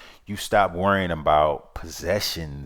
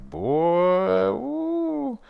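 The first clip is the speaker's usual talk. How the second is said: mock sassy, high swooping ooh; a playful diva brushing someone off; sassy cut-off; 10 semitones higher, much slower than usual. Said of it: "And I'm like".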